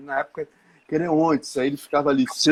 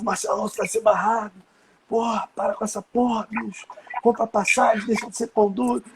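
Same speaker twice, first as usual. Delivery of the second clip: high, frantic scolding of a friend; agitated nagging; 7 semitones higher than usual. Retold or imitated. Friend panicking at the gate, high agitated scolding. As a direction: nag him in a panic. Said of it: "Ele ficava louco".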